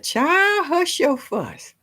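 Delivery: high, slow Southern grandma scolding; playful grandma impression; imitating grandma. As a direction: mimic a country granny hushing a child. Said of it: "She'd be like".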